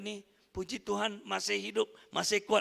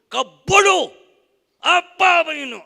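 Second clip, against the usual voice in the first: high, loud mock yell at a child; angry scolding; 8 semitones higher, 17 dB louder than usual. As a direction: bark it like an angry parent. (ibu bapa menengking anak dengan marah)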